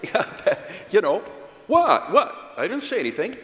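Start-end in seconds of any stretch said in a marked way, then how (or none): 1.7-3.4 high-pitched, protesting "who, me?"; mock innocent denial. Speaker acts out someone caught by their body language, mock innocent denial. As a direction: play innocent, voice jumping up high. Acting out defensive denial in argument.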